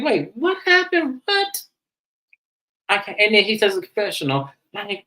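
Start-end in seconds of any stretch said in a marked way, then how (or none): 0.0-1.6 high-pitched mock disbelief; startled questioning. Imitating a man hearing surprise news, startled high questions. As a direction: ask it way up high, drawn out. Retelling J J's startled reaction.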